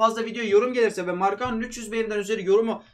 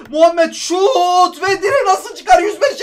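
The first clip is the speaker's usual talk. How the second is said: slow, drawn-out wail, higher and much louder; anguished cry; 8 semitones higher, 9 dB louder, much slower than usual. Real, not acted. Gamer wailing as the shot hits the post, slow and loud. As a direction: howl in disbelief at the post.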